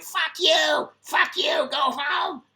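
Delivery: high-pitched imitation of a shouting man; mock screaming. Retold Chinese guy screaming at him, mock yell. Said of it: "Screaming at me".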